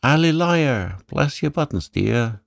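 solemn bird croak, pitched well up; parrot's grave croak. A narrator's reading. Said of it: "gravely croaked".

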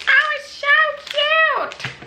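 very high, slow squeal; cutesy squeal. Real, not acted. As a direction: coo at something adorable. Gushing over cupcake.

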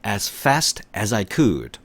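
very slow, every word drawn out; teacher's slow repeat-after-me pace; slow model reading. Shown as a demonstration. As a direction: read it slowly for students to repeat. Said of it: "시작".

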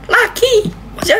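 very high squeak of surprise; startled squeak. Real, not acted. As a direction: squeal at yet another gift. Another gift pops up. (cicitan kaget yang sangat tinggi)

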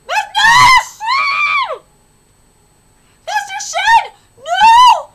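real anguished screams; desperate screaming. Real, not acted. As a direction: scream at the screen as a friend falls. Watching Shen die.

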